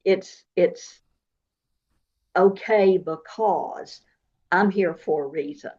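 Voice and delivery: normal talking voice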